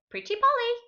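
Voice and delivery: high squawky parrot. A narrator's reading. Narrator squawks as the other parrots, high parrot voice. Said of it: "Other parrots could say".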